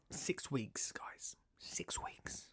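hushed, ominous whisper. Acted. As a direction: breathe it like a dark secret. Dreading the six-week holiday.

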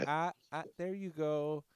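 mock fussing over someone; mock cooing. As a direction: coo it like wiping a child's chin. Retelling dabbing her chin like a baby.

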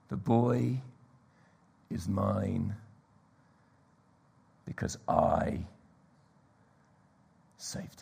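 soft, slow, fading to a whisper; hushed claim. Acted. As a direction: let it sink in quietly. Quiet climax voicing the hero.

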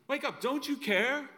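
high, pleading cry of the disciples. Retold or imitated. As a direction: beg him to wake up. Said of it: "That's what they say".

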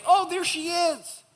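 high, slow swoon of mock joy; mock adoring delight. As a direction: coo it in mock rapture, way up high. Mock adoring husband on waking.

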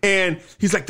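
ordinary delivery

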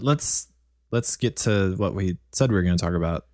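ordinary talk, nothing marked

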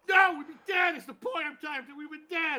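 high, exasperated shouting; angry guy. Acted. Angry guy arguing they would be dead, exasperated shouting. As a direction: insist on the point, at wit's end. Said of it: "you're the angry guy who didn't make the".

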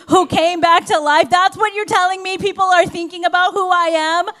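loud, high, exasperated rant; acted indignant tirade, hammering the key words; incredulous outburst. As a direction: pile up the grievances in rising disbelief. Acting out Jesus' incredulous reply.

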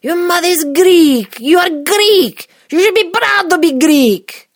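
outraged waiter at full volume; high, drawn-out scream of indignation. Retold or imitated. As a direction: scream it, stretching each phrase. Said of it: "The waiter screams".

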